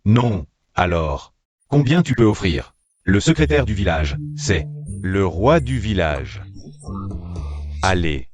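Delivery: ordinary delivery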